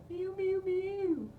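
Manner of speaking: very high, soft mews. Shown as a demonstration. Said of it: "another exercise you could do is mew".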